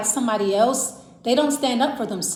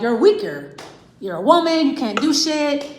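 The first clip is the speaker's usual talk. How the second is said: louder, higher sneering taunt; mock bullying partner; 5 semitones higher, 5 dB louder than usual. Insecure partner picking on the woman at home, loud jeering taunt. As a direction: belittle her, loud and contemptuous.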